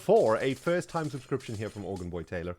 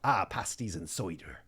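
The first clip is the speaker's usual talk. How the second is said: rough attempt at Devon; Devon accent; 2 semitones lower than usual. Shown as a demonstration. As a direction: Devon yokel with a splash of Scottish. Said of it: "Try Devon?".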